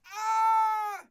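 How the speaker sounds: high, loud open-throated yell; frustrated yell. Real, not acted. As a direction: let out a frustrated scream. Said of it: "I'm a goddamn mess".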